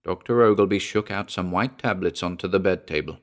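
usual speaking voice

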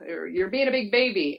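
mock a parent telling the kid to toughen up; imitating an exasperated sports parent; impatient tough-love parent. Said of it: "parents wanna, like, mentally tough at their kids".

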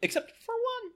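squeaky, very high cartoon voice; helium voice. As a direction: talk like you sucked a balloon. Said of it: "let the helium out of my voice".

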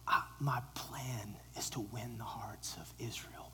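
soft intimate whisper. Acted. Jesus whispering to Peter about the nations, hushed. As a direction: lean in and whisper the vision.

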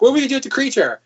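high, loud anxious question; worried assistant. Retold or imitated. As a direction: fret like a lab assistant. Acting Igor and Frankenstein scene.

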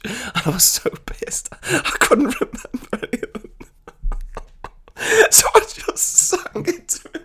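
words lost in high laughter; can barely speak for laughing. Real, not acted. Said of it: "sorry if you didn't get that".

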